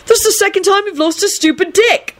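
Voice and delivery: high, exasperated angry rant. A narrator's reading. Neville telling off forgetful Lupin, angry. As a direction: rant at him in high-pitched anger. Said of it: "Neville said angrily".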